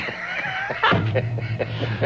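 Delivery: high shrieking laugh; shocked burst of laughter. Retold or imitated. Host hearing the curse on air, shrieking laugh. Hears four-letter word on air.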